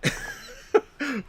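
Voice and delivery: high breathy giggle; laugh. Real, not acted. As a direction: giggle at your own failed impression. Laughs at his bad accent.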